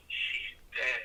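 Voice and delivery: low, soft imitated reveal; hushed, low retold bad news; grim confidence. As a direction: drop the secret softly. Grim secret revealed in story.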